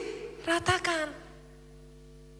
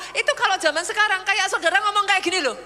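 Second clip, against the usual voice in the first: usual speaking voice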